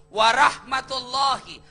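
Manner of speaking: "Warahmatullahi" drawn out slowly and loud; preacher stresses each blessing to the congregation; slow emphatic warahmatullahi. Shown as a demonstration. Slow, loud emphasis. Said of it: "Salamnya orang Islam itu lengkap".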